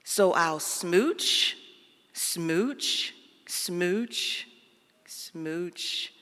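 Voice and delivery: very slow, fading smooch, smooch; slow smooches. Acted. Fish ending the book with slow fading smooches.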